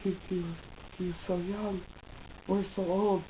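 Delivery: soft, mock-mournful quote; imitating sadness. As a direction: act out hushed movie grief. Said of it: "like, really sad".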